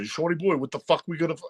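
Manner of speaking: high, excited mock Brooklyn uncle; playing the frantic Brooklyn uncle. Imitating a Brooklyn uncle planning a trip, in a high Brooklyn rant. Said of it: "my Brooklyn uncles trying to figure out".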